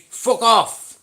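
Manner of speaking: sudden high, loud yell; shouted dismissal. Real, not acted. Streamer breaking off his story at an interruption, shouted dismissal. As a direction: explode mid-sentence into a shout.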